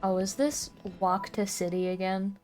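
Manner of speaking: ordinary talk, nothing marked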